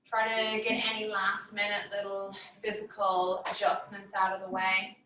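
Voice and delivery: measured, soothing yoga nidra voice; guided meditation. Real, not acted. Yoga teacher settling listeners down for Yoga Nidra, guided meditation voice. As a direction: guide them into stillness, phrase by phrase with long pauses.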